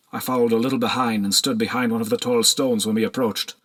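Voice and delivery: ordinary delivery, nothing marked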